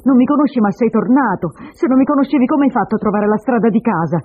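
loud, high, taunting cross-examination; angry challenge. Acted. Woman confronts her supposed returning husband, angry. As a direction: throw his words back at him.

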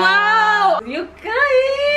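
high, thrilled squeal; delighted amazement. Real, not acted. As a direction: gush over a wonderful gift. Opening a gift.

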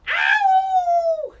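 high, loud wolf howl. Shown as a demonstration. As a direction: howl at the full moon. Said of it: "Can you howl at the full moon?".

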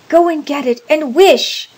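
high, loud urgent cry; quivering excitement. A narrator's reading. Wife commanding her husband, loud excited cry. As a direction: order it in feverish excitement. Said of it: "cried his wife, quivering with excitement".

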